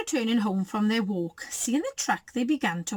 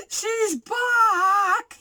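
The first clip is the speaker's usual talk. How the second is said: high, loud, drawn-out wail; 11 semitones higher, 5 dB louder than usual. A narrator's reading. Baby Bear trembles at the sight, wailing. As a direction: wail it in little-bear terror. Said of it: "he wailed".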